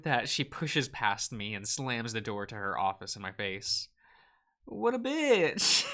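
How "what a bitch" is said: squeaky high indignant outburst; exaggerated high offended voice; mock outrage. Real, not acted.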